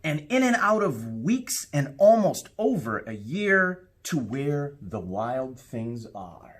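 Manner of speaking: put-on lively read-aloud for children, rising with the adventure; storybook narration. A narrator's reading. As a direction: tell it like a bedtime story to a class. Reads picture book aloud.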